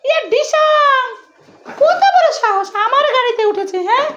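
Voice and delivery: put-on high, bossy kid scolding; indignant child. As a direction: scold like an outraged toddler. Parent voices child scolding intruder.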